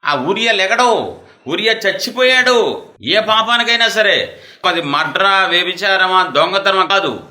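high, loud pulpit cry; fiery preaching. Acted. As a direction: preach it out at full force. Preaching at full force.

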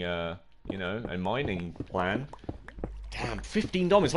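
ordinary talk, nothing marked

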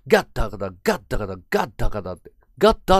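ガッタカダ with "go" turned to "ga", run together; ガッタカダ, the final "d" of God swallowed; slurred native-style GOD. Shown as a demonstration. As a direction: rattle ガッタカダ as one word, hard "gat". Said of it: "英語の発音がゴッドがガッってなる".

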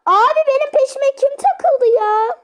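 high alarmed yelp; being chased. Real, not acted. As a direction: cry out, something's on your tail. Zombies chasing him.

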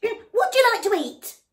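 loud frog. Acted. Noisy frog loudly introducing himself to the elephant. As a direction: proudly bellow the name.

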